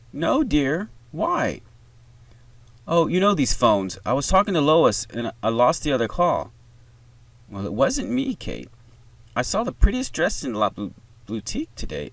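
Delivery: put-on high-pitched, chatty older mother; older woman. A narrator's reading. Mother on the phone to grown daughter, older female voice. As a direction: chatter lightly as an older lady. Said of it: "older female voice".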